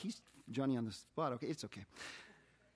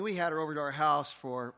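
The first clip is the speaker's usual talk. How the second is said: usual speaking voice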